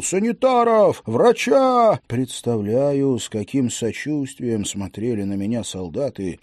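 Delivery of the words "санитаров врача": high, loud desperate cry for help; frantic yelling. A narrator's reading. Officer calling for help, a panicked yell. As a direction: scream for a doctor. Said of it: "завопил".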